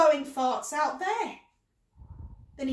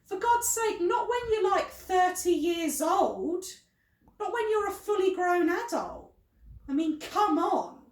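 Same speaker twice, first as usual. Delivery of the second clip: high, exasperated complaint; 7 semitones higher, 4 dB louder than usual. Acted. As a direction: protest in exasperation, pitch up. Exasperated complaint about his behaviour.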